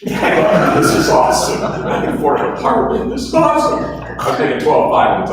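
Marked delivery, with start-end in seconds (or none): 0.0-3.8 laughing, then a louder high cry on awesome; overjoyed, laugh through it; thrilled. Retold or imitated. Learning his new salary, laughing and thrilled.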